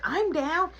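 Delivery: put-on high little-kid voice; high-pitched mock child eagerness; eager kid. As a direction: pipe up like a child volunteering.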